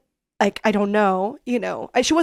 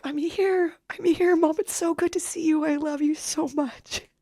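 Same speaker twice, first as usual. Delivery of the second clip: tender comfort; 7 semitones higher than usual. Retold or imitated. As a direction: soothe her mother gently. Comforting mom tearfully.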